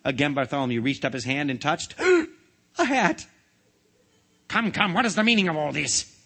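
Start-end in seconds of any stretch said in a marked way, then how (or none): 2.0-3.3 sharp gasp, then high, loud exclamation; shocked discovery. Acted. Boy finds yet another hat. 4.5-6.1 higher, exasperated royal demand; enraged demand. A narrator's reading. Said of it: "his face purple with rage".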